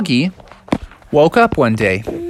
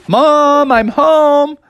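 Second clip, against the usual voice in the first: calling out; 12 semitones higher, 5 dB louder, much slower than usual. Acted. Calling into the house.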